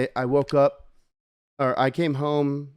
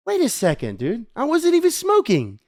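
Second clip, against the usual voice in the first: indignant realization; 10 semitones higher than usual. Retold or imitated. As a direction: pitch climbing into high mock outrage. Retells his delayed indignant realization.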